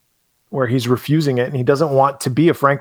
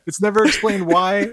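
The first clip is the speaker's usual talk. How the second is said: mock outrage; 8 semitones higher than usual. Repeated for emphasis.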